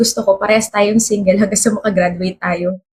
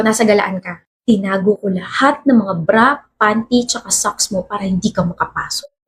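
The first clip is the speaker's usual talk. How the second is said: ordinary talk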